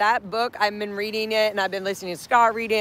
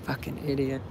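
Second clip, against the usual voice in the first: ordinary talk, nothing marked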